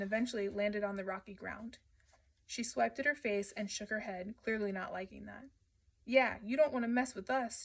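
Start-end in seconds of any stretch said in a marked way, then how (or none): 6.0-7.6 screaming. Acted. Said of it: "Jesse screamed".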